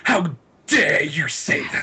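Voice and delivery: slow, hammed-up tearful indignation; theatrically wounded outrage; mock outrage. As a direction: draw it out as if scandalised. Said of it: "How is that like?".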